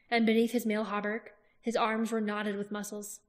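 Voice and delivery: ordinary delivery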